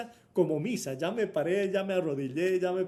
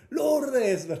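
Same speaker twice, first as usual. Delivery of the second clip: louder, higher shout of a name; 7 semitones higher, 7 dB louder than usual. Retold or imitated. Bursting into his sister's house, shouting her name. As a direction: yell the name from the doorway. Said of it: "pegué un grito así".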